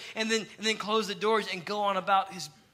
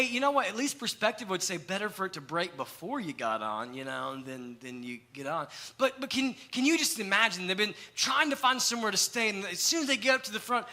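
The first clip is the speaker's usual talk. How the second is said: usual speaking voice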